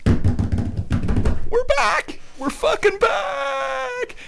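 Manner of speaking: huge high triumphant yell; excited shout. Real, not acted. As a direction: scream it out in celebration. Celebrating Formula One's return.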